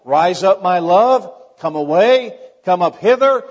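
loud, ringing summons; shouting. Acted. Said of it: "he's gonna say something like".

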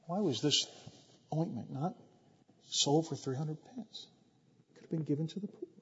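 very soft, furtive murmur in short phrases; hushed whispering. Shown as a demonstration. Preacher re-reading Judas's complaint as a sly whisper, hushed. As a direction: whisper it like a schemer in the background. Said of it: "I should say it like this".

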